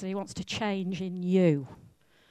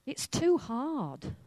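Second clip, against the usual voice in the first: slow, higher-pitched whine; whining excuse; 6 semitones higher, slower than usual. Acted. Man at the pool makes his excuses, whining. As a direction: drag out the complaint, pitched up. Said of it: "he's whining and he's making excuses".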